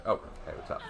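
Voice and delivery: hushed whisper to someone nearby; quick whispered hello; low soft whisper; whispered aside. Real, not acted.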